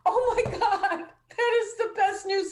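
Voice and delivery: overjoyed, laughing, high-pitched gush; thrilled squeal. Retold or imitated. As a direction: squeal the good news with a laugh. Thrilled reaction retold to publisher.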